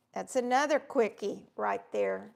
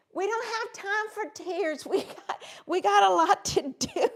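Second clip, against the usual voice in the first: a lightly voiced, higher, brisk friend; friend's brisk pep talk; 7 semitones higher, 4 dB louder than usual. Retelling what Jennifer said before the photos, mildly mock-stern. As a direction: hurry her along, no time for tears. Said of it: "And she said".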